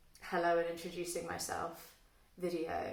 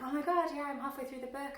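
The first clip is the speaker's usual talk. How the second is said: imitating excited friend; 8 semitones higher, faster than usual. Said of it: "and be like".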